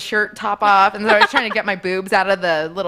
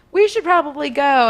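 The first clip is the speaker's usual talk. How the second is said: higher, louder quoted line; pitched-up quote of the date; retold suggestion; 7 semitones higher, 5 dB louder than usual. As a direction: lift the voice for his suggestion.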